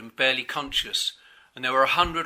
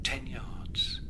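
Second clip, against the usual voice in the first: very soft, near-whispered; hushed brooding; 8 dB quieter than usual. A narrator's reading. Captain weighs the distance to his wounded friend, hushed. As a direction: murmur it softly to yourself.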